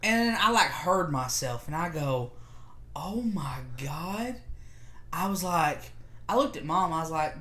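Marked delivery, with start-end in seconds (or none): 2.9-4.3 slow, hushed realization; stunned whisper. Retold or imitated. Speaker hears herself sound like kid, stunned whisper. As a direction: whisper it in mock horror. Hearing own catchphrase, stunned.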